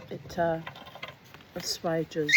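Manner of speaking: plain reading voice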